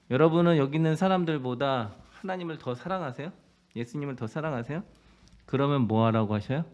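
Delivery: usual speaking voice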